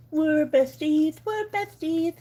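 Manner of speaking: "besties" lisped, the s turned to th, high and cutesy. Real, not acted. Owner to her dog, lisping "besties" in baby talk. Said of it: "I instantly have a lisp".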